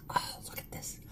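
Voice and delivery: breathy excited whisper. Real, not acted. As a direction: hush it like a secret. Showing food, excited hush.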